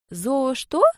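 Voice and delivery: high, curious little voice; ask, utterly enchanted; puzzled child. Acted.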